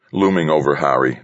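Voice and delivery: ordinary delivery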